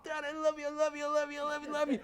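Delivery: high, sing-song doting dog voice; dog's voice. Pretend. Owner voicing his dog's love for him, high doting voice. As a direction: speak as the adoring puppy.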